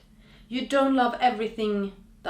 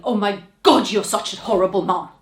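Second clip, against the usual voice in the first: mock accuser; 12 dB louder, faster than usual. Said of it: "who's saying".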